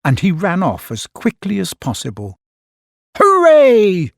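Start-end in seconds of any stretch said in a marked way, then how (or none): 3.2-4.1 high, loud cheer. A narrator's reading. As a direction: cheer it out, much higher and louder. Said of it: "cheered the crowd".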